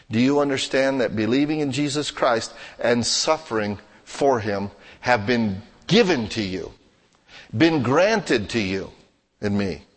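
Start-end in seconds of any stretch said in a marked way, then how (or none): none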